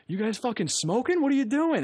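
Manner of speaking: high, mock-angry outburst; cop losing it. Cop to smoking teens at the car, mock outrage. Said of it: "he loses his shit".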